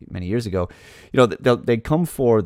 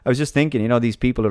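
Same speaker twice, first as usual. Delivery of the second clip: usual speaking voice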